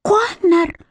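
high, horrified gasp of a name. A narrator's reading. Sister trying to stop her brother, alarmed gasp. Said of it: "ахнула Алекс".

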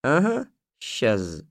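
slow, drawn-out scoff; mock the listener's doubt. A narrator's reading. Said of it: "насмешливо ответил старик".

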